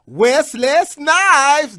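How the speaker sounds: high, loud, slowly stretched name; starstruck shout. Retold or imitated. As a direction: drag out Wesley Snipes loudly. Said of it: "I would say".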